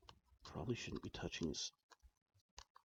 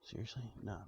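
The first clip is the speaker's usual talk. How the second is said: normal talking voice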